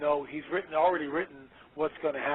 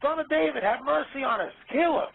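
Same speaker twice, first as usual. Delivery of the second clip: high, louder pleading call from far off; retold yelling; 9 semitones higher, 4 dB louder than usual. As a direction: call out to Yahshua as the lepers begging. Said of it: "So they're yelling at Yahshua".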